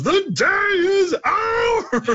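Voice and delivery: very high, slow cracking shout; voice crack announcer. Retold or imitated. Imitating the game announcer for a friend, cracking high shout. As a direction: let the voice break on a triumphant cry. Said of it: "voice crack announcer".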